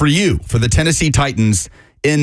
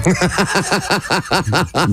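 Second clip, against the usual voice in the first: laugh; 3 semitones higher than usual. Real, not acted.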